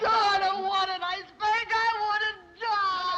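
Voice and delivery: high wail, slowing on the last words; hammed-up despair, pitched high; hysterical wail. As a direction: wail it out like a melodramatic child. Daughter having a crying spell.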